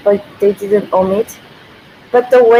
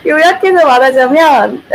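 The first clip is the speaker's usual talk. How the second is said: laughing; 4 semitones higher, much faster than usual. Real, not acted. Laughs hunting for English word.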